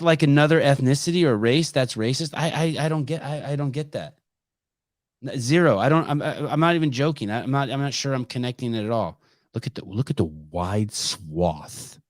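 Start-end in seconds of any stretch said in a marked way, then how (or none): none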